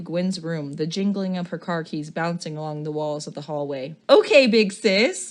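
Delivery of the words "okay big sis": loud, slow teasing big sis; mocking sibling voice. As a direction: drag it out to tease her sister. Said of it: "Gwen replies, referring to her sister".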